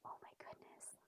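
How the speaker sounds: very soft breathless whisper. Real, not acted. Reader whispering worry to the children. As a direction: hush it like a secret.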